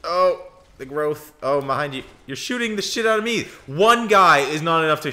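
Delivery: panicked heads-up to teammate; alarmed outburst. Real, not acted. Sudden enemy swarm in game.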